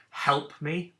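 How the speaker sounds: short, punchy exclaimed plea; mock shout. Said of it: "'cause they're shouting it".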